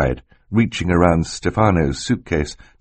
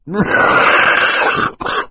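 long, loud coughing fit; hacking cough; 13 semitones higher, 4 dB louder than usual. A narrator's reading. Banker overcome by a fit, coughing loudly.

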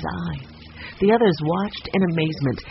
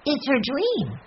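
put-on high child voice; earnest little boy; 9 semitones higher, slower than usual. A narrator's reading. Benny explains the motive to his sister, high earnest child voice. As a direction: pipe it up as a small boy.

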